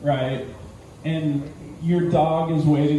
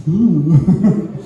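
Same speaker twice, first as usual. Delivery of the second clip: creepy cat coo; 7 dB louder than usual. Acted. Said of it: "Creepy ass feline predator".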